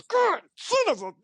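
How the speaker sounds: very high, strained mock cursing; pissed off. Ballplayer cursing while he signs the ball, pissed off. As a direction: blow up like an irritated star, hammed-up.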